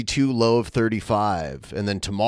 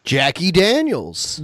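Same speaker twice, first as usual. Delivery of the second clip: drawn-out, louder repeat of the name, pitched up; slow emphatic repeat; 7 semitones higher, 4 dB louder than usual. Shown as a demonstration. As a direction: savor the name Jackie Daniels loudly. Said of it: "Have you met my girlfriend, Jackie Daniels?".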